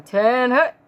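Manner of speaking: barked parade-ground command; drill sergeant shout. Acted. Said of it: "shouted her command in true drill, drill sergeant form".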